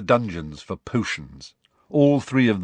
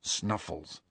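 low, hushed reply; quiet; 4 semitones lower, 11 dB quieter than usual. A narrator's reading. Harry naming the letter's sender to friends, quietly. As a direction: say it softly, keeping it private. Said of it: "said Harry quietly".

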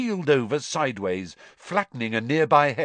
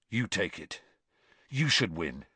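soft, lower, sincere offer; quiet; 6 semitones lower, 6 dB quieter than usual. A narrator's reading. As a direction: concede gently. Cedric's quiet noble offer.

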